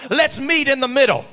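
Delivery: Let's MEET in the middle, shouted high with MEET hit hard; shouted preaching. Shown as a demonstration. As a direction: belt "meet" out to the church. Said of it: "can I say it like this".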